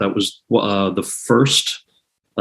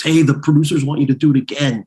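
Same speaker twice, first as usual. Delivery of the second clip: lightly voiced casting director, pitched up; casting director calling after; 5 semitones higher than usual. Casting director running after the actor to his car, calling out. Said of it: "casting director was running after me".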